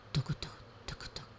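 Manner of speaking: very soft voiceless toc toc; hushed creeping clip-clop. Acted. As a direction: tiptoe the hooves in a hush. Horse tiptoes slowly.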